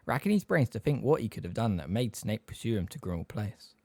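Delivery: plain reading voice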